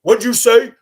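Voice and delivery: high, loud confrontational what'd you say; the driver shouting back, looking for a fight; angry challenge. Retold or imitated. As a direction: snap it back like a threat.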